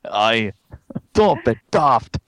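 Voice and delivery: Scottish lilt on aye and daft; Scottish accent. Pretend. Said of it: "That's Scottish".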